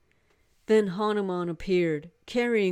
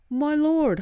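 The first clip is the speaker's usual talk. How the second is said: high, loud, worshipful Hanuman voice; a devoted monkey god bursting with joy; eager monkey god; 9 semitones higher, 7 dB louder than usual. Acted. Monkey god greeting his lady Sita, high and eager.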